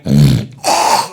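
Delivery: loud, low snort and gasp; exaggerated loud sniff with exhale; cocaine snort. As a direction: imitate snorting a line hard. Imitates snorting a line of cocaine.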